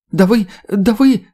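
high, loud, slow sputter; hysterical stammering. A narrator's reading. Woman gropes for words at the men, hysterical stammer. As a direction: grope for words in shrill outrage. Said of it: "затроила та, пытаясь подобрать слова".

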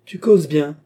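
ricanement moqueur, plus fort; ricanant. A narrator's reading. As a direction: se moquer de lui, plus haut. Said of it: "ricanait-il". (Grimbaud ricane devant Mathias, moqueur)

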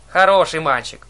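loud, eager cry; shouted praise. A narrator's reading. Old sister praising the boy holding her eye, shouted. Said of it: "крикнула Дрожь".

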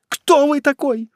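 high, slow, drawn-out question; terrified cry. A narrator's reading. Condemned man to a stranger, terrified cry. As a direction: ask in mounting dread. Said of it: "вскричал Муздаман, побледнев как мертвец".

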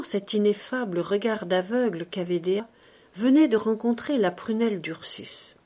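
plain reading voice

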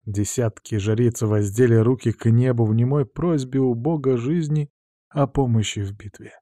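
ordinary delivery

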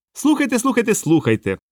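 high-pitched, overjoyed clown squeak; little clown, high and eager; gleeful clown. A narrator's reading. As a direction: call for attention in a high, delighted voice. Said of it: "радів він".

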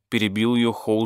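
ordinary delivery

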